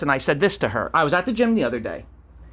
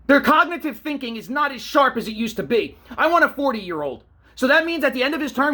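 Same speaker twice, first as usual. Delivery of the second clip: exasperated rant; 7 semitones higher than usual. Real, not acted. Rant about old candidates.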